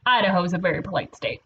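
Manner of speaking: usual speaking voice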